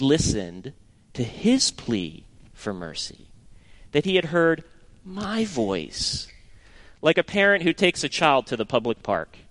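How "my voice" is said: "my" held long and higher, softly; soft, marvelling weight on "my"; stress on MY. Shown as a demonstration. Preacher driving home that God heard him personally, stressing "my". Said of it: "Now notice the emphasis".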